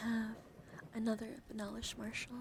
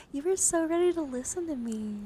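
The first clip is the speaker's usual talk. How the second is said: gushing praise; 7 semitones higher, 10 dB louder than usual. Pretend. Streamer praising the camera for focusing, gushing. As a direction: gush proudly, pitch soaring.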